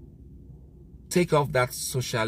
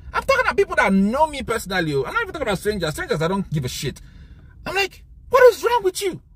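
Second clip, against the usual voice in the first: angry rant; 8 semitones higher than usual. Real, not acted. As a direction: shout your disbelief at them. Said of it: "I'm angry, very angry".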